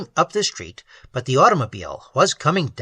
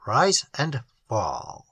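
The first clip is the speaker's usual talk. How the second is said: drawn out, much slower and softer; 4 semitones lower, 5 dB quieter, much slower than usual. A narrator's reading. Narrator lingering on the swallowing, slow and hushed. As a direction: let the repeat sink slowly.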